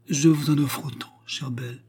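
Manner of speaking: breathy sighed whisper, trailing away; a weary widow sighing her complaint. A narrator's reading. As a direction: sigh the words out on a whisper. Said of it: "soupira la veuve".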